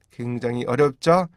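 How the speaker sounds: normal talking voice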